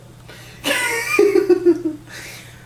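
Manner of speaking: bursting into a high laugh; loud high laugh. Real, not acted. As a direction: let out a big laugh. Laughing at own joke.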